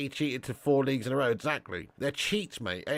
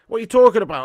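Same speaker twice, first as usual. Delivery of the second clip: much louder, higher exasperated question; loud disbelief; 7 semitones higher, 12 dB louder, much faster than usual. Real, not acted. Streamer arguing with Cake Cadet in chat, loud disbelief. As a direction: demand it of the chatter in disbelief.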